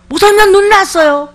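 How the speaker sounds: eager congregant. Retold or imitated. Congregant quote.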